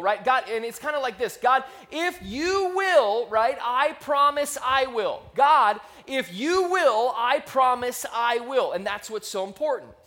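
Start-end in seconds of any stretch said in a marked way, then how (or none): 1.4-8.7 slow, louder, higher pleading to God; pleading prayer. Pretend. A person bargaining with God, slow pleading promises. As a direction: stretch out each promise like a desperate prayer.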